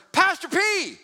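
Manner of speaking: loud high call across the aisle. Retold or imitated. Fan spots pastor in store, shouts his name.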